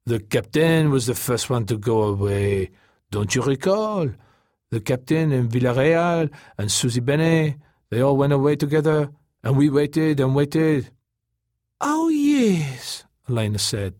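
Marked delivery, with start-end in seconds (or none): 0.0-10.9 Taka's lilting voice, drifting higher; patient explaining. A narrator's reading. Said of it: "proceeded to explain". 11.8-13.0 very high, drawn-out agreement; Elena's character voice. A narrator's reading.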